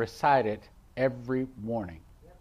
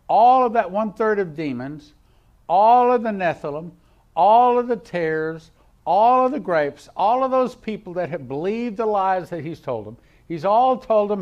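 raised, loud list with each "all" hit hard; forceful preaching; 5 semitones higher, 7 dB louder than usual. Real, not acted. Preacher listing all whom the devil deceived, forceful preaching. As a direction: hammer out each item like a preacher.

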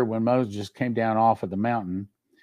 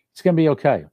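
emphatic reassurance; 5 semitones higher, 5 dB louder, much faster than usual. Real, not acted. Preacher to brothers and sisters, loud emphatic promise. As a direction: lift it high and strong, full conviction.

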